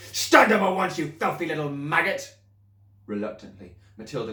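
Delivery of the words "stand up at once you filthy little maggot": much higher, louder, far-off barked command; angry order. Acted. Headmistress ordering the pupil up in anger. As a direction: bark the order.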